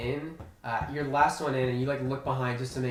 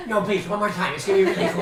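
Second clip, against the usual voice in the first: lightly voiced pleading Igan, louder; Igan begging; 6 semitones higher, 7 dB louder, faster than usual. Game master voicing Igan begging the old cook, louder eager pleading.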